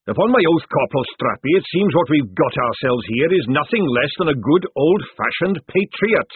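loud, booming fat sergeant; hearty sergeant. Acted. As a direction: boom it like a jovial recruiter.

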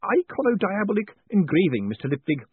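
high, bubbly, eager craftsman; a kindly engraver tripping over his thanks; pleased old tradesman gushing over a visitor; eager Mister Spools. A narrator's reading. Spools shows his work.

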